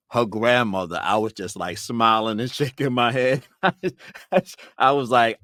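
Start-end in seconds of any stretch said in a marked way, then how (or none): 3.6-4.7 high burst of laughter; a real, bright laugh at the memory. Real, not acted. Laughing while telling of Margaret's grandmother, a high laugh.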